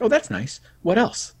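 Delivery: high, airy feigned indifference; mock nonchalant.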